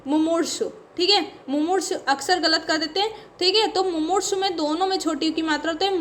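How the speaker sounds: ordinary delivery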